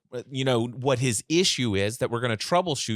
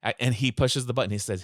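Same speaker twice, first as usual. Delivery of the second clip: ordinary talk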